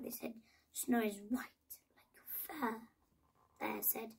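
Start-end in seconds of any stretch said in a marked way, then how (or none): 0.7-2.9 hushed, breathy reply like a secret; soft whisper. A narrator's reading. Bear answering the friends, in a soft whisper.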